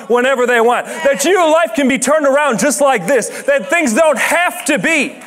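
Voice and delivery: preaching. Real, not acted. Pastor urging the congregation, rising preacher fervor. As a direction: drive it home to the whole room.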